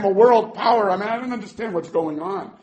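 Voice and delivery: ordinary talk, nothing marked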